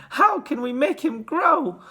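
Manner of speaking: high, slow, pleading wail; despairing mother's lament. A narrator's reading. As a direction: beg it tearfully, drawing it out. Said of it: "wailed Missus Teavee".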